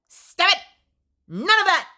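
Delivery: high, loud sharp shouts; hammed-up telling-off; mock scolding. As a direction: yell it like shooing someone off. Scolding the gushing peers.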